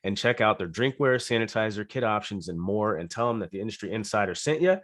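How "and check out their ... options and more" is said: normal talking voice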